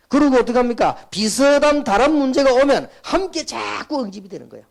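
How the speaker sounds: high, loud preaching cry; forceful preaching. Acted. Preacher driving home his point to the congregation, high and loud.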